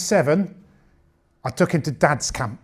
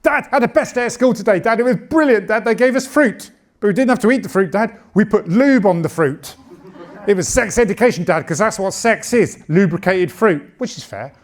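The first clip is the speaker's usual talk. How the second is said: lightly voiced excited kid, higher; excited child; 5 semitones higher than usual. Son telling dad about school, a hint of excited child. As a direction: gush like an eager schoolboy. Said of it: "he came home from school really excited".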